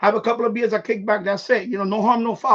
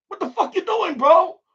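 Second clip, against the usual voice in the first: much higher, indignant shout at the tenant; retold angry yell; 9 semitones higher, 4 dB louder than usual. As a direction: bark it at him, outraged. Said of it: "I'm like".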